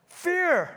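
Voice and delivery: long pause, then fear high; drawn-out fear. Acted. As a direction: hold the silence, then lift fear up high. Dramatic pause before fear.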